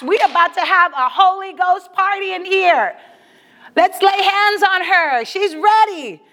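high, hyped-up calling out of orders; rally the whole church for a party; excited rallying. Acted. Imagined rallying of church for baptism.

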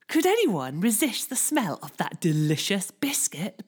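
ordinary delivery, nothing marked

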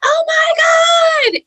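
high, drawn-out excited scream; full-pitched shriek, stretched slow; retold scream. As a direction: scream it out long and loud, reenacting disbelief. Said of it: "And I just screamed".

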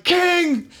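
high, carrying call across the crowd; high drunk shout of "King!". Retold or imitated. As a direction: hail the king over the heads of the crowd. Calling out to the king.

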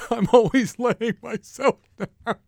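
high, loud, laughing punchline; mock-despairing laugh; laughing self-mockery. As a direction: laugh it out at yourself.

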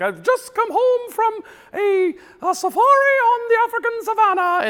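very high prim little horse voice; squeaky tiny English horse; small horse voice. Retold or imitated. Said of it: "she just talks about all these crazy things".

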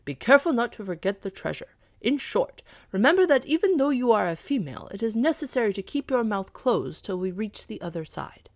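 loud, emphatic dog lecture; stern cautioning dog. Acted. Dog instructing the cat before crossing, sternly cautioning. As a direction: lay down the warning forcefully, voice rising.

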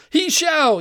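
loud, high insistent yell. A narrator's reading. Giant poking his friend in the ribs, yelling. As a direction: yell back, pitch shooting up. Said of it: "yelled the first".

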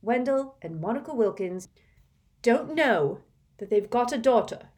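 put-on high, hard-pressed girl's voice; a girl scolding her friend who doubts her; fierce. A narrator's reading. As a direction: lay it out with tight fierce resolve. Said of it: "a rather fierce look".

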